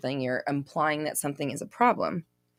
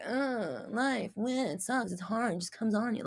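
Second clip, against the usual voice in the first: mock whine, soft and slow; hammed-up moping, dragging the words; whiny self-pity; 4 semitones higher, 8 dB quieter than usual. Mocking a viewer who moans about life. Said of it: "instead of just being like".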